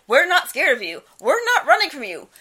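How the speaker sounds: hammed-up taunt thrown at a ghost; imitated taunting. Co-host mimics ghost hunter taunting the ghost, mock defiance. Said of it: "he goes to taunt the ghost".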